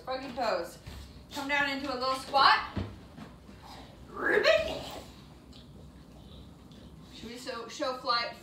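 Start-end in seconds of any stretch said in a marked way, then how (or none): 4.1-4.8 much higher squeaky ribbit; frog croak. Pretend. Teacher doing froggy pose for kids, high ribbit. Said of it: "Let's do our froggy pose".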